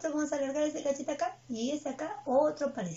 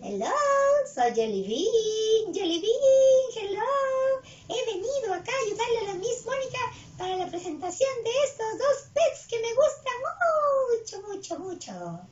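high, singsong puppet voice; high-pitched cartoon character, stretching key words; Jelly Bean; 9 semitones higher, 4 dB louder than usual. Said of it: "un amigo que ustedes conocen".

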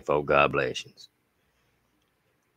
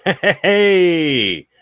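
excited hey; 12 semitones higher, 7 dB louder than usual. Real, not acted. Excited shout before reading chat.

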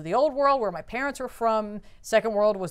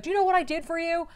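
higher, fast, imitating a furious son; mock angry yell; 8 semitones higher, faster than usual. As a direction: fire it back fast as a hurt accusation. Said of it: "he's just screaming at him".

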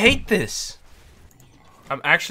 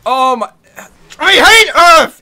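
high, loud angry outburst; frustrated yell; 11 semitones higher, 14 dB louder than usual. Real, not acted. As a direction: yell your hatred at the game. Raging at the game mode.

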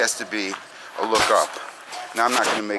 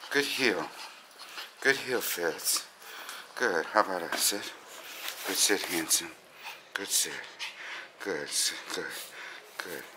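soft, lower praise; calming down; 5 semitones lower, 11 dB quieter than usual. Real, not acted. Trainer bringing excited dog down to earth, soft and calming. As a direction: quietly settle an excited dog. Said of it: "Let's bring him down to earth".

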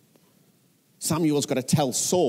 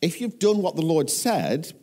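high, pointed challenge; demanding; 7 semitones higher than usual. A narrator's reading. Samuel challenging Saul over his claim, demanding. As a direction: press the question as a demand. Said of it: "Samuel demanded".

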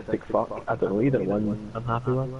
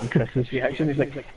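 normal talking voice

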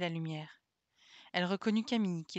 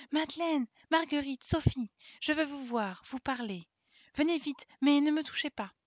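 voix d'enfant haute, appelle ses amies de loin; appel joyeux; 6 semitones higher, 3 dB louder than usual. A narrator's reading. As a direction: lance les prénoms bien fort, tout excitée. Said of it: "elle se dirigea vers elles en criant". (fillette qui appelle ses amies au jardin, voix haute et joyeuse)

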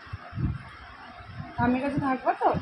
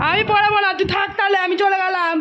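loud scolding; 7 semitones higher, 6 dB louder than usual. Real, not acted. Scolding the crying child. (জোরে বকুনি)

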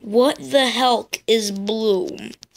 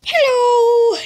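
squeaky high creature hello; minion voice; 12 semitones higher, 5 dB louder than usual. Acted. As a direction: chirp it like a tiny minion. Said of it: "they like to say".

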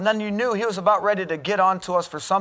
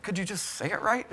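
laughing; 3 semitones lower, 9 dB quieter than usual. Real, not acted. Laughing at coach's mispronunciation.

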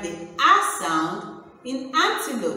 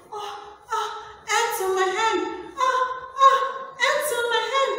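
play-acted fright, climbing higher with each cry; mock screaming; 13 semitones higher, faster than usual. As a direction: act out a small, squeaky scream of alarm. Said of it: "as she was screaming".

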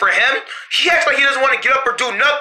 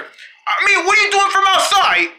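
agitated; 9 semitones higher than usual. Acted. Accusing Momo, agitated.

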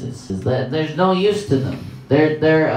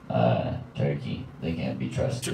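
deep, softer ominous verdict; low ominous voice; 11 semitones lower, 9 dB quieter than usual. Acted. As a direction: drop the voice darkly.

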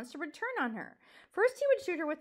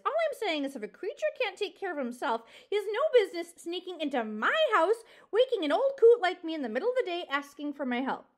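grumpy scolding; 4 semitones higher, 6 dB louder than usual. Acted. Porcupine scolds intruding mouse.

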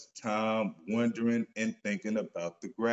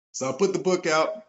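normal talking voice

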